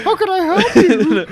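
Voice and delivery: very high, loud falsetto with laughter; mock Big Mama voice. Teasing a friend by answering the bank in a high lady voice. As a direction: laugh through a squeaky put-on lady voice.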